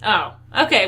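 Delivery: ordinary talk, nothing marked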